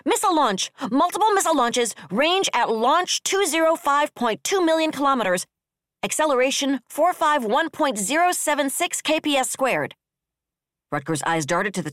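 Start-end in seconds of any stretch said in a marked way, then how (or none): 0.0-9.9 high, loud tracking-rating report; sudden announcement. A narrator's reading. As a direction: call out urgent readings at a high, raised pitch. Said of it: "one of his tracking ratings announced suddenly".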